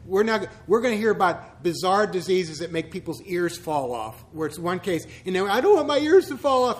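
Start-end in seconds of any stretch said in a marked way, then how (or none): 5.5-6.8 high, loud imitated fretting viewer; hammed-up fearful cry, much higher; mock panic. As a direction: whine it like a scared TV watcher. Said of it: "we're wondering".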